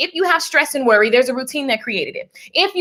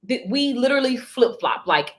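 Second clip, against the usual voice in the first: usual speaking voice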